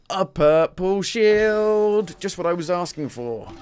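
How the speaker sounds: slow, high, loud delight; excited. Real, not acted. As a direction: savour the purple shield. Excited at game loot.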